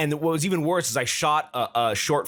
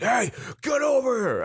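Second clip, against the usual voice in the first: high-pitched shout across the street; mock cop holler; shouted police order; 12 semitones higher than usual. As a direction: imitate a cop yelling an order. Retells officer ordering him over.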